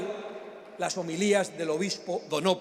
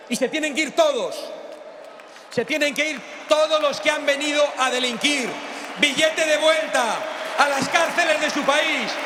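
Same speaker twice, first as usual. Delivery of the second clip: booming balcony speech; 4 semitones higher than usual. Real, not acted. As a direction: whip the crowd up, chant-like. Rally shouting at crowd.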